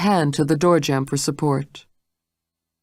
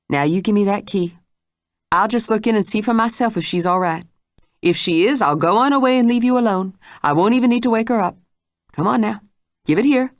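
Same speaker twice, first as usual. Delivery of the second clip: higher, faster coaxing demand; insistent Mrs Stitt; 6 semitones higher, faster than usual. Acted. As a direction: talk her into handing it over. Mrs Stitt coaxes the key.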